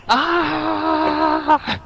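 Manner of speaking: long high-pitched acted scream; Victor. Game master voices the player's character, high drawn-out yell. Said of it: "Victor yelled".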